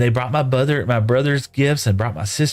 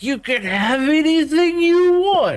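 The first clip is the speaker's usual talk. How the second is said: much higher, slow, over-generous parent voice; guilty parents; slower than usual. Retold or imitated. Parents tell forgotten son he can have anything, exaggerated guilty. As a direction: play it like parents making amends. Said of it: "my parents got in there like".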